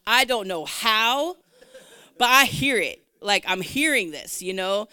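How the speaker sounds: loud, baffled retold insistence; emphatic insistence. Insisting she hears the song.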